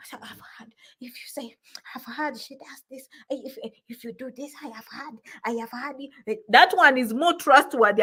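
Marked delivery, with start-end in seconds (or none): 0.1-6.4 half-whispered rumour, very soft; barely voiced, secretive murmur; hushed gossip. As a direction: pass it on like a fearful secret. Acting out fearful rumour-spreading gossip.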